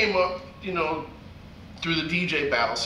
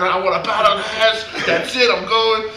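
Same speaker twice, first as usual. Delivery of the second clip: loud, raised retold declaration; acted-out determination, pitched up; fired-up resolve; 6 semitones higher, 7 dB louder than usual. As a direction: announce it like you're done waiting. Retelling his resolve to battle.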